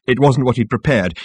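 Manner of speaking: ordinary delivery, nothing marked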